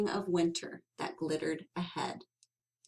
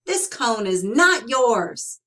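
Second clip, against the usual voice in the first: cried out; 3 semitones higher, 8 dB louder than usual. Acted. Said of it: "cried Sorrel".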